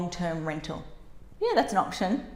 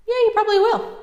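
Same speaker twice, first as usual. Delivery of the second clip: high, loud mock agreement; sarcastic; 14 semitones higher, 8 dB louder than usual. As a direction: squeal it sarcastically. Sarcastic reply to client.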